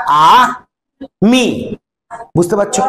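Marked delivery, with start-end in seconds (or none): 0.1-1.8 "আ", pause, then "মি"; split into syllables. Shown as a demonstration. Teacher dividing a word for pupils, split into syllables. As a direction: say "আ" and "মি" as two separate drawn-out syllables. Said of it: "আমিকে ভাগ করলে কি করবে".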